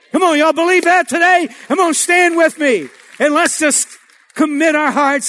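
rally the crowd to their feet; rousing altar call. Real, not acted. Preacher calling congregation to stand.

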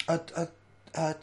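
high, stammering hesitation; flustered old lady. Acted. Old lady faced with a second security man, flustered. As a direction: grope for words in a fluster. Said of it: "she's, she's flustered".

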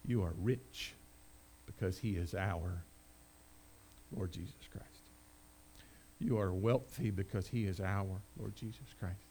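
very soft and low, with long pauses; hushed solemn delivery. Real, not acted. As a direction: let each phrase sink in quietly. Hushed, solemn point after story.

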